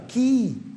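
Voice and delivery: key said with a long "ee"; long I sound. Shown as a demonstration. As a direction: say key as "kee". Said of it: "Como é que você fala chave?".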